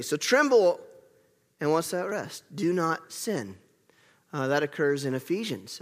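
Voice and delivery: ordinary talk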